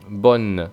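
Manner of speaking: Bonne with a crisp sounded N; clear final N. Shown as a demonstration. Teacher showing the final consonant in bonne. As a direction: land firmly on the N. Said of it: "tiene que sonar claramente la N".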